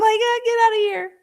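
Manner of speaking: high-pitched scoffing dismissal; mock disbelief. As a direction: wave it off in an exaggerated high voice. Acting out disbelief at the ruling.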